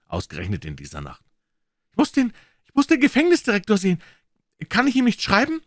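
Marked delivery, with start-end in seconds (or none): 1.9-5.6 very high, loud, fast desperate plea; high, rushed desperate begging; frantic plea. A narrator's reading. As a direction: beg urgently. Prisoner begs to see director.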